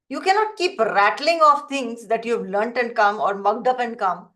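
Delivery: ordinary talk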